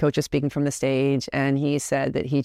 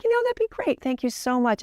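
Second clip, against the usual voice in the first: much higher, gushing thanks; sweet grateful daughter; 9 semitones higher than usual. Retold or imitated. Daughter thanking her dad, bright and much higher. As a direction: play it sweet for dad, way up high.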